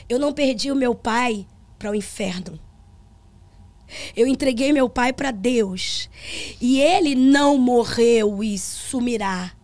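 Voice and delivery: preaching to the church. Retold or imitated. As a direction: proclaim it slowly from the pulpit. Retells her words from pulpit.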